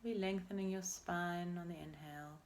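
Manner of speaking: normal talking voice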